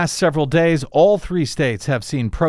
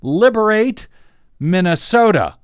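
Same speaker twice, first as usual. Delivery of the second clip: mock tweet-yell, higher and louder; sarcastic mock shout of the tweet; all-caps shout; 8 semitones higher, 5 dB louder than usual. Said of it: "two words in all caps".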